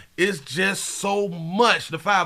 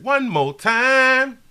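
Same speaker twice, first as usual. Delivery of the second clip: hyped call; 6 semitones higher, slower than usual. Real, not acted. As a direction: shout it to hype the crowd.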